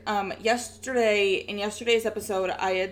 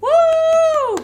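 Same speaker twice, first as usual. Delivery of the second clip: high, loud excited whoop; excited cheer; 11 dB louder than usual. Real, not acted. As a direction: whoop it out with glee. Cheering for Halloween.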